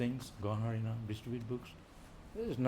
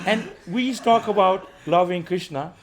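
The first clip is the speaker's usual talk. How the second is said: laughing; 8 semitones higher, 12 dB louder, slower than usual. Real, not acted. Laughing while contrasting.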